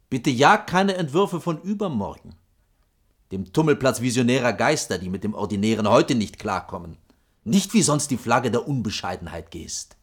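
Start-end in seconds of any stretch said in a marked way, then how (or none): none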